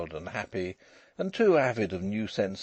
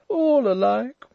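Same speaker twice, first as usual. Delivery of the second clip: high, loud, overbearing voice; loud, high-pitched drawler; drawling toff; 9 dB louder, slower than usual. A narrator's reading. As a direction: question him like a lordly toff. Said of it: "drawling, overbearing fellow with an eyeglass".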